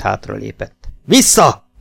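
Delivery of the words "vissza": high, loud one-word order; yelled command. A narrator's reading. Jakes orders Kemel back as he retreats, yelling. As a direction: bellow him back into place. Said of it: "ordította Jakes".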